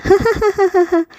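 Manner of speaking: put-on high, giggling child laugh. Pinky laughs with grandmother, high giggle. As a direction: burst into happy giggles. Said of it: "दादी भी हंसने लगी".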